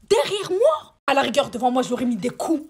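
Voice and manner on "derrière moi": high, loud outraged exclamation; scandalised, voice jumping up; indignant outburst. Real, not acted. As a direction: blurt it out in disbelief. Indignant rant about girls behind her.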